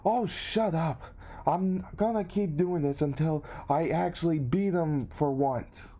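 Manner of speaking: high-pitched, stubborn retort without real shouting; mock yelling. Rival yelling back after being laid out, defiant mock yell.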